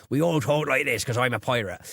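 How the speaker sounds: lightly voiced Cornish pirate; a hint of a pirate burr; mock pirate accent, half-hearted. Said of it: "when we do impressions of pirates".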